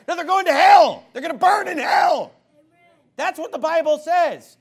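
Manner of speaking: high, loud preacher's shout. Real, not acted. Preacher shouting hell at the congregation. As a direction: thunder it from the pulpit.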